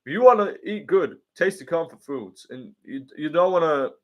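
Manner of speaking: mock American accent pitching a cookbook; lightly done American accent impression; American accent [name]. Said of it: "put on your American accent".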